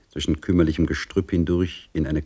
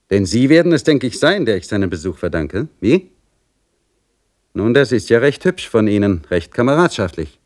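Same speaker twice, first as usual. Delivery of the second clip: higher, louder mocking servant voice; sneering Simeon; 4 semitones higher, 4 dB louder than usual. Simeon taunting the maid at his door, higher and louder. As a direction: taunt her with sly threats.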